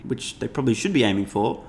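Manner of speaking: normal talking voice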